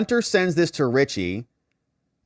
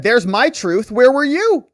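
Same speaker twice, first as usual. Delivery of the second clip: higher, louder, pushing back hard; mock indignant shout; 11 semitones higher, 7 dB louder than usual. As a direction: throw the question back at her, loud. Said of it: "Hunter said".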